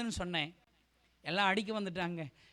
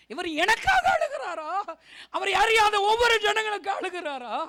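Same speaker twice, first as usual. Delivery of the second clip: anguished amazement; 10 semitones higher, 8 dB louder than usual. Retold or imitated. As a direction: cry out the question in overwhelmed wonder. Relives his astonished realisation. (வியப்பில் உரக்க நீட்டிக் கதறி)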